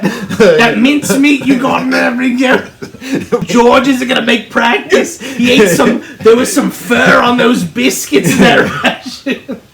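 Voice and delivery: put-on British accent. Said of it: "like a British accent".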